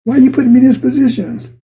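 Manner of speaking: high, indignant demand; Jonah arguing. Acted. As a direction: throw the question back at God. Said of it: "Him and God had an argument".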